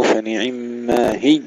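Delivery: "هي" as hiyy with a tense doubled y; wrong, doubled ya. Shown as a demonstration. Teacher shows another common stopping mistake, ya with shadda. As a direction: press hard on the ي of "هي". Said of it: "بالتشديد".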